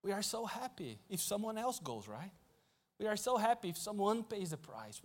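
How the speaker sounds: quiet serious voice of God calling him out; God speaking gently. Acted. God gently confronts Louis, soft and earnest. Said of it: "God really spoke to my heart".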